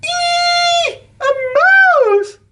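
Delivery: shrieking very high and loud; a famous singer shrieking at a mouse; screaming. A narrator's reading. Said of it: "screamed Alicia Botti".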